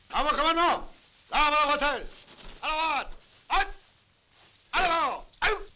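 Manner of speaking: barked parade-ground commands, high and loud; drill sergeant. Acted. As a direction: bellow orders across the yard. Sergeant drills marching soldiers.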